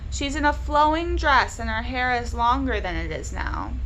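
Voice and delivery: ordinary delivery, nothing marked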